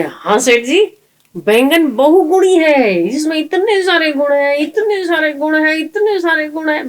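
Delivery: butter up the master eagerly; flattering servant. Retold or imitated. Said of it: "बोले". (सेठ की हाँ में हाँ मिलाता चापलूस नौकर)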